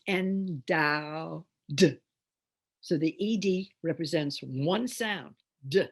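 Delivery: ENDOWED said as "en-dow-d"; broken into syllables. Shown as a demonstration. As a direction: stretch "endowed", detaching the final d. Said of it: "is not a separate syllable".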